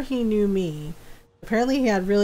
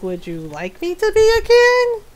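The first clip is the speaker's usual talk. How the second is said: high, mock-childlike questioning; sarcastic offer; 10 semitones higher, 3 dB louder than usual. Woman answering a caller who doubts she's adult, high sarcastic offer.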